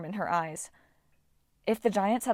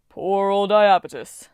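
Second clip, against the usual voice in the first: slow, loud, pitying Titan; a booming Titan's slow false pity; slow mock pity; 8 dB louder, slower than usual. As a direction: drag it out with fake sympathy. Koios mocking pity.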